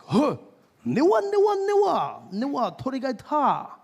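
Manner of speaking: high, loud fake Japanese chant; loud fake Japanese phrase; mock Japanese. Shown as a demonstration. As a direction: bark it high and strong. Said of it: "I could also say".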